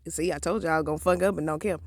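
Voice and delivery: hushed confession; quiet aside. Real, not acted. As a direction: drop it low to the crowd. Aside to audience.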